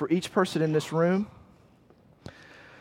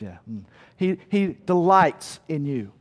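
usual speaking voice

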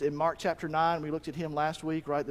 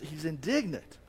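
ordinary talk

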